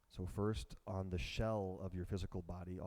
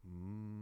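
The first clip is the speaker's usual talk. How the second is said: humming bee breath; 5 dB quieter than usual. Shown as a demonstration. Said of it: "begin Brahmari".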